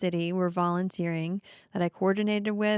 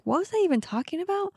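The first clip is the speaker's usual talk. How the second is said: retold confusion; 11 semitones higher, faster than usual. Retelling losing her train of thought.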